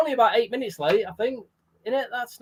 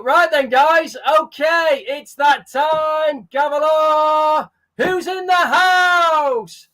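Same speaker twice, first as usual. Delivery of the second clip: higher, louder, slow call to the crowd; hype announcer; 8 semitones higher, 5 dB louder, much slower than usual. Acted. Host opens the stream roll call in a loud announcer call. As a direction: open the show like a ring announcer.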